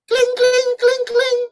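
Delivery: high-pitched ringing clinks; sing-song bell jingle; imitated bell. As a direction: mimic a little bell, high and even.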